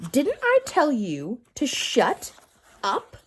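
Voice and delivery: loud, slow, drawn-out scolding. A narrator's reading. Teacher scolding Todd again. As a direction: stretch the words out angrily.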